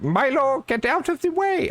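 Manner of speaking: very high-pitched mock Brazilian accent; attempted Brazilian accent. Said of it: "I gotta say it in a Brazilian".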